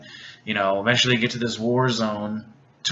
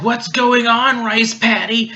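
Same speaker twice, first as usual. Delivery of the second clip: high, loud, drawn-out mock outcry; agitated demand; 11 semitones higher, 5 dB louder, slower than usual. Millie bursting in on Rice Patty, mock agitated. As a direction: call it out high and loud, stretching the words.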